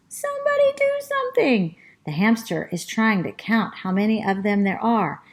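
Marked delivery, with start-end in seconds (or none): 0.1-1.8 very high panicked squeak; squeaky rat. Acted. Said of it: "The rat squeaks".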